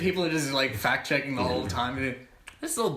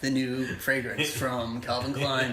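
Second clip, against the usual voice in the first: sultry perfume ad; 2 semitones lower, slower than usual. Pretend. Mock perfume ad voiceover.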